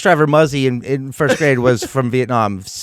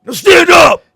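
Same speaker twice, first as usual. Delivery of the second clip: barked order, much higher and louder; drill sergeant yell; 12 dB louder, slower than usual. Retold or imitated. Bus driver ordering kids to stand, drill sergeant yell.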